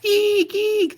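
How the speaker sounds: very high squealing eek; mock shriek. A narrator's reading. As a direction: squeak like a frightened mouse. Narrator shrieks in horror.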